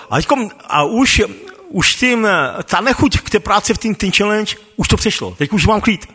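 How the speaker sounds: ordinary talk, nothing marked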